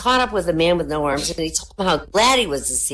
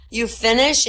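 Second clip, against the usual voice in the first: higher, breathless question from a spent lover; worn-out mock pant; exhausted gasp; 7 semitones higher than usual. A narrator's reading. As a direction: ask it winded after exertion. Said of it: "gasping, barely able to turn his head".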